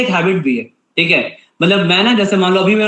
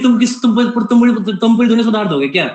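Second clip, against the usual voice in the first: imitating wife angry; 6 semitones higher than usual. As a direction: mimic the wife snapping at him, quick and high. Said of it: "Ritika बहुत गुस्सा करती है". (पत्नी की नकल, ऊँची तेज़ नाराज़ आवाज़)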